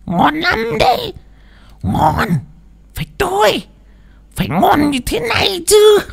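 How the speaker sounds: the spirit savours the raw fish in a high voice; possessed smacking praise. Acted. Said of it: "mà tấm tắc". (giọng vong nhập cao, khen ngon)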